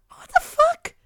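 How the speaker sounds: very high, loud squeal of disbelief; exaggerated falsetto bafflement; incredulous disbelief. Disbelief at the soupy order.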